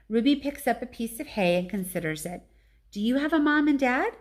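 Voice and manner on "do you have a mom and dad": put-on higher child voice, innocent; innocent little elephant. A narrator's reading. Baby elephant asks Ivan about parents, innocent child voice. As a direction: ask it plainly like a small child.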